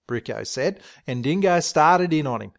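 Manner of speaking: ordinary delivery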